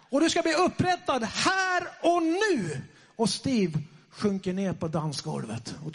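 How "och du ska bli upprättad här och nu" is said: high, slow, emphatic proclamation; proclaimed prophecy. Retold or imitated. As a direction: declare it like a prophet, drawing out the words. Re-enacting the prophecy shouted at Steve.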